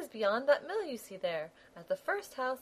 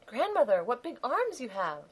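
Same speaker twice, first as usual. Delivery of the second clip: put-on high, wondering child's voice; amazed little girl. Girl staring at the disguised wolf, high and amazed.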